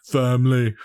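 a hint of Vin Diesel; Vin Diesel impression. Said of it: "my Vin Diesel impression".

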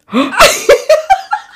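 high loud laughing gasp. Real, not acted. Friend gasping at the broken bracelet story, laughing.

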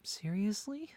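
high rising disbelief; a startled seriously; surprised. Acted. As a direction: ask it in a surprised, high rise. Hopeful surprise at wife's anger.

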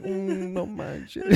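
slow, high lightly voiced kid; Mexican Spanish accent. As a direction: drawl it out like a sick kid. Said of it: "He's like".

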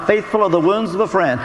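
ordinary delivery

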